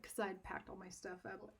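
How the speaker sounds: ordinary talk, nothing marked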